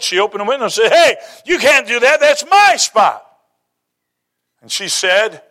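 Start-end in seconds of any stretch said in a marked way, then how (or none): 0.9-3.3 higher, louder, indignant protest, the last words drawn out slowly; upset older lady. Retold or imitated. Older lady in the parking lot yells at the driver who stole her spot, indignant. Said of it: "And she was upset".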